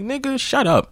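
very high mock hush; imitating the dude. Dude mutters to himself, very high mock voice. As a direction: squeak a mocking shut up. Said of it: "a bunch of, like".

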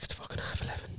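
hushed, breathy under-the-breath whisper; a sneaky whispered complaint to Hannah about the early exit; whispered aside. Retold or imitated. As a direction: whisper it so only your mate hears. Retold quip to friend at bar.

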